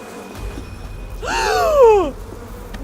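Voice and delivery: sharp loud high gasp; shocked gasp. Real, not acted. Sudden shock at bees vanishing.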